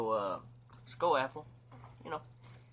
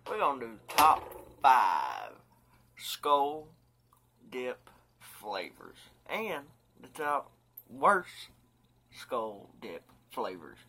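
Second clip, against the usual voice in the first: big drawn-out announcer read; dramatic announcer voice; 8 dB louder, slower than usual. Shown as a demonstration. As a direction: announce it like a countdown show. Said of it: "dramatic countdown announcement".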